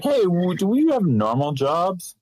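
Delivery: bright put-on question voice; mock naive question. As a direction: play a clueless townsperson. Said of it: "It's like".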